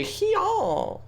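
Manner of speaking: very high, squealed catchphrase; put-on catchphrase. Streamer mocking a line to always say, high squeal. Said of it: "Just always say".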